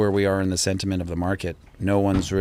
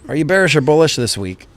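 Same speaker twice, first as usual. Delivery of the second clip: higher, playful voice for his son; upbeat dad voice, higher and louder; 6 semitones higher, 7 dB louder than usual. Real, not acted. Asking son on stream.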